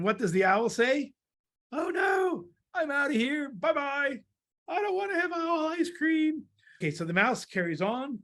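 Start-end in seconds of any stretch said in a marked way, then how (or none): 1.7-6.4 high squealed cartoon panic; panicked owl. Owl flees from being owl ice cream, acted panic. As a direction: squeal it as you flee.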